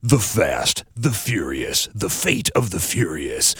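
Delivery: low, hushed, portentous movie title; mock-dramatic title reveal. Critic introducing the film to listeners, mock-dramatic. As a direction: intone each title low and soft, with weight.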